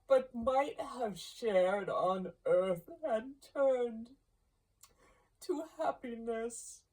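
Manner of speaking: high, hollow lamenting ghost; wailing ghost. Acted. Marley's ghost lamenting his fate to Scrooge, high mournful wail. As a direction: moan it out like a lost soul.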